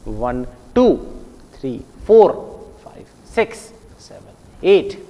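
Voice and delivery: वन, three, five, seven nearly swallowed, टू, फोर, six, एट hit firmly; every second number stressed. Shown as a demonstration. Teacher counting every second one for pupils, stressing टू, फोर, six, एट. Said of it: "हर दूसरे को गिनना है".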